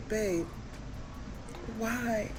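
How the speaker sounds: softly voiced fake despair; fake distraught. Girlfriend hamming grief at boyfriend, softly. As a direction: play the wounded girlfriend, quiet. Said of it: "play the distraught girlfriend".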